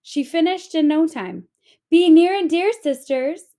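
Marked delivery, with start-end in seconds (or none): 1.9-3.5 loud high call to her sisters. A narrator's reading. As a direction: sing out to the sisters nearby. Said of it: "she said, crawling inside for a nap".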